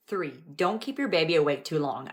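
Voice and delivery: usual speaking voice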